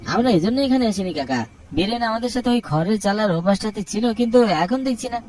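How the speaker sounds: put-on boy urgently telling the elder his friend is missing; pleading boy. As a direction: press the elder, worried.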